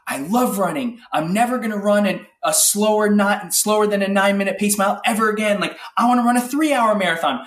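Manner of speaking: pumped-up self-talk. Retold or imitated. Retelling his post-race hype to the host, eager and excited. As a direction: rattle off the goals like you can't wait.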